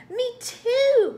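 very high, loud pig outburst; loud piggy cry, TOO swelling; grunting pig. A narrator's reading. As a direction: burst out like an eager pig. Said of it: "grunted Blimpy the pig".

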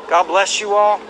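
usual speaking voice

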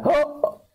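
squeaky stabbed gasp; hammed-up shocked cry; high mock gasp. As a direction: yelp a high "Oh!". Victim stabbed.

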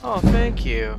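bright, delighted thank you; thrilled, drawn-out thanks; excited thanks. Real, not acted. Excited at getting a lesson.

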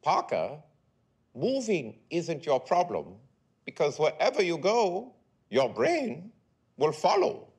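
lofty, deliberate therapist pronouncement; authoritative elderly Freudian analyst. Acted. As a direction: play a grand old Freudian handing down a verdict. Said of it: "He has a very authoritative manner".

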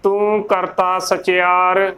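slow, careful scripture line; slow recitation. Shown as a demonstration. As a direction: recite each word deliberately. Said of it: "ਸਾਈਂ ਉਚਾਰਨ ਕਰਨਾ ਹੈ".

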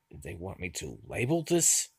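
ordinary talk, nothing marked

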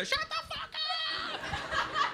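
very high, stretched-out shriek; comic sleepless fury; exasperated scream. Acted. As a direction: screech it like someone kept awake. Yells again at the music.

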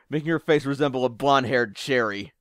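ordinary delivery

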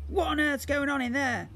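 angry sister Jemima; demanding. Acted. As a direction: demand it through the door. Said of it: "a voice demanded".

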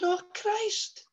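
high falsetto mother recognising someone; mother's voice. Retold or imitated. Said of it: "she said".

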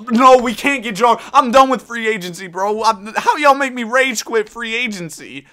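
high, loud frustrated yelling; real rage at the game; rage quit. Real, not acted. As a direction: shout it out, fed up with the game. Rage quitting free agency.

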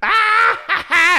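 very high mock scream-laugh; imitated shriek. Imitating Mother God as the kids arrive, very high shriek. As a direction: shriek out a put-on cackle. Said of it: "and Mother God".

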